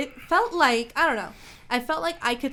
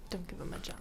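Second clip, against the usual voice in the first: hushed teasing; 4 semitones lower, 14 dB quieter, much faster than usual. Pretend.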